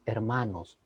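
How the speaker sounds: soft, low, measured preaching; low and quiet, with weighty pauses; change of intonation. Shown as a demonstration. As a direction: drop to a hushed, grave appeal. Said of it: "vieron que yo hice un cambio".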